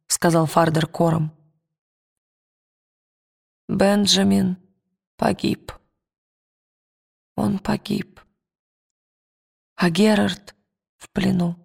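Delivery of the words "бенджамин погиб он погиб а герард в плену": hoarse with broken breathing. Acted. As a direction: rasp it out, gasping between words. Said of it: "Голос у него был хриплый, а дыхание прерывистое". (хриплый голос, прерывистое дыхание)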